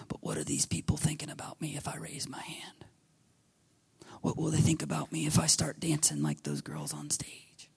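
soft whispered self-doubt; hushed worried murmur; anxious inner whisper. Retold or imitated. Voicing worshippers' anxious inner thoughts.

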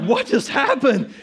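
slow, high and loud through laughter; laughing astonishment. Retold or imitated. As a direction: drag it out, laughing in amazement. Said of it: "I'm like".

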